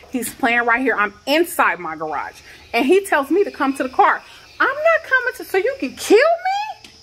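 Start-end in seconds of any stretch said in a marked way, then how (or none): 4.6-6.9 much higher, strained and frightened; frightened disbelief. Real, not acted. Mother explains why she won't go to the cop's car, frightened. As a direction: voice jumps high in fear and disbelief. Fears the cop will kill her.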